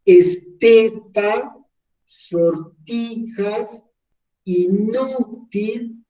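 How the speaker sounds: Estepa, sortijas, inútil slow and high, middle syllable stretched; slow list, each second-last syllable lifted; penultimate stress. Shown as a demonstration. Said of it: "si la entonación es en la penúltima".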